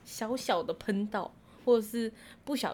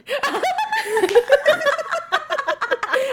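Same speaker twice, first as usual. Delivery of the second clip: high squealing laugh; a real high laugh; burst of laughter; 7 dB louder than usual. Real, not acted. Laughing outburst.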